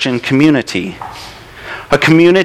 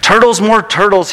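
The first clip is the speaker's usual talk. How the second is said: storybook bellow; 8 semitones higher than usual. A narrator's reading. Turtle king calling for more turtles, high bellow. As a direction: bray it out like a demanding king. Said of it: "he bellowed and brayed".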